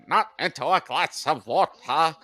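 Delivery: high, slow, hammed-up cartoon voice; cartoon dog impression. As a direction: ham up the high diving dog. Requoting cartoon dog's line.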